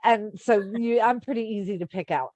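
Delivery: usual speaking voice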